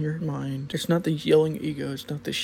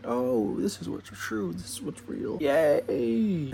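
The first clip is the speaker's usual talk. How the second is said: breathy, airy mock cheer ending in a Yay; mock naive delight; slower than usual. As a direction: play the dreamy believer with head in the clouds. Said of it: "and going".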